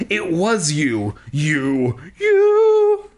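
rising to a loud sung wail on the last you; hammed-up accusation. [name] tearing apart Mister Teddy, hammed-up shrieking accusation. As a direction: belt the final you like a crazed diva.

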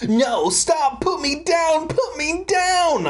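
very high acted shriek of protest. A narrator's reading. Sammy lifted off the track by Brent, shrieking. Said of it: "I shrieked".

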